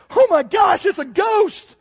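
high, loud panicked cry; terrified shout. Acted. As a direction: scream it like you've seen a ghost. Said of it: "they were terrified".

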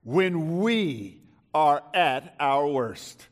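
very slow, loud proclamation that falls away; booming opening, measured descending close; emphatic preaching. Real, not acted. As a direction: stretch every word so it sinks in. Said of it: "I'll say it again".

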